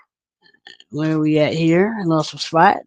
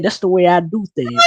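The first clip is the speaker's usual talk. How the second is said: ordinary talk, nothing marked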